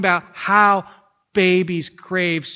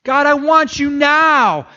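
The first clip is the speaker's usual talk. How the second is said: high, loud, slow crying out to God; passionate plea; 9 semitones higher, 7 dB louder, slower than usual. Acted. Preacher models craving for God, loud passionate cry. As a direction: beg God with a baby's urgency.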